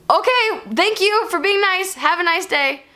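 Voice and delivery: high, sing-out pleasantry, falling away; mock politeness. Her brushing off the rude driver, mock politeness. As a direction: chirp a pointed thanks. Retold sarcastic sign-off to driver.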